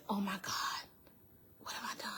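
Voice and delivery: near-whispered retold shock; hushed horror. Gunman realizing he has shot her, as she retells it, hushed horror. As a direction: let it sink to a stunned whisper.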